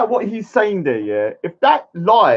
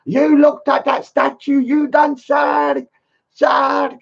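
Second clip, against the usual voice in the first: hammed-up accusing host calling out; mock scolding; 9 semitones higher, slower than usual. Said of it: "M States is going".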